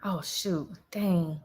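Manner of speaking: breathless whispered aside. Real, not acted. Noticing something mid-live, a hushed whisper.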